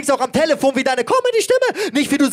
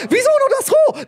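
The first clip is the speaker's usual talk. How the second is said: mocking rival's question; 11 semitones higher than usual. Rapper mimics his rival asking, in a high mock whine. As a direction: squeak it out like a baffled opponent. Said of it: "Und wenn du fragst".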